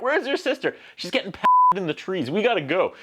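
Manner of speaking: high, urgent mock alarm; high-pitched pretend fretting; mock family panic. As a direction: round up the family in a play panic. Acted family panic as world explodes.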